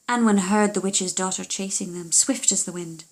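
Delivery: plain reading voice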